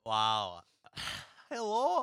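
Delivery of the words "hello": high, tentative go at Irish; attempted Irish accent. Shown as a demonstration. Host testing an accent he says he can't do, shaky-footed Irish attempt. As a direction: try out an Irish hello, unsure. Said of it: "I can't do Irish at all".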